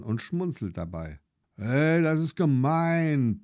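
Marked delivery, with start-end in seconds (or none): 1.6-3.4 higher, slow indignant protest; a boy objecting that it's unfair; mildly hammed-up child's protest. A narrator's reading. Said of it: "protestiert Lars".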